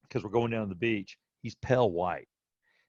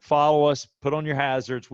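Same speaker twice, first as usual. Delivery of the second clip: loud, raised retold command; calling out instructions; 4 semitones higher, 6 dB louder than usual. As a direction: call it out like an ambulance crew. Ambulance crew calling out instructions.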